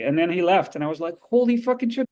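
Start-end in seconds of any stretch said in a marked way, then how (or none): 1.2-2.1 high-pitched amazed exclamation; retold stunned disbelief. Actor retelling meeting his co-star, stunned disbelief. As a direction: blurt it out higher, starstruck in hindsight.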